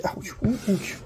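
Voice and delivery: quick pained yelps from a hot dish; burnt fingertips. Real, not acted. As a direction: yelp as the hot pan burns. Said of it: "It's hot".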